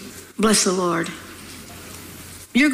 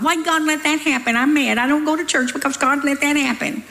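mock whiny churchgoer, higher; whiny complainer; 4 semitones higher than usual. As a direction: mimic people blaming God. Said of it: "People are always blaming God".

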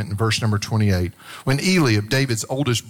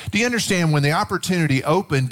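normal talking voice

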